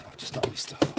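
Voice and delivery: real whispered grumble to himself; real muttered whisper after knocking things over; muttered to self. Real, not acted. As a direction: whisper it under your breath, annoyed. Knocking gear over mid-stream.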